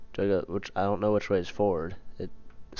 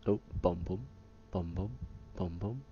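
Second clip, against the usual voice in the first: imitated heartbeat; 10 dB quieter than usual.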